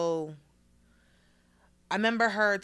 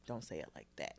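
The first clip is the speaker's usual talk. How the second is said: teacher's quiet word; 7 semitones lower, 15 dB quieter than usual. Retold or imitated. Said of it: "She's like".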